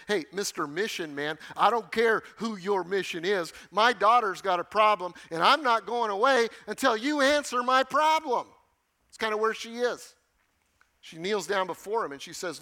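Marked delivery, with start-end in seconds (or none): 0.1-8.5 rising to a loud, high demand; desperate mother pressing Jesus, growing loud; imitating a pushy mother. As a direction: builds from plain to a loud, high insistence. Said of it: "I can imagine her coming to him".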